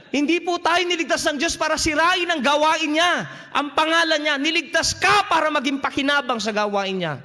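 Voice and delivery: forceful preaching. Acted. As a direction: preach it hard at the congregation. Preaching rises to full force. (malakas na pangangaral sa mga kapatid)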